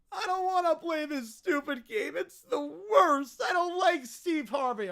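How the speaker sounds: put-on bratty child's tearful protest; petulant kid in a mock tantrum; whiny kid. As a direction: whine and lean hard on the complaint. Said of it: "peak whiny kid territory".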